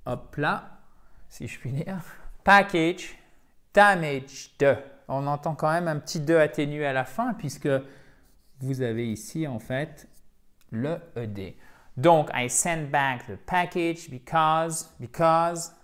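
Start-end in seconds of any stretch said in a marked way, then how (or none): 2.4-4.8 "package" and "damaged" said loudly with a short i in the last syllable; short i endings. Shown as a demonstration. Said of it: "Ce qui nous donne". 12.3-15.7 "I sent back the package because" read slowly, stress on "back" and "pack"; stressed model sentence. Shown as a demonstration. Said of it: "faites bien l'accentuation ici".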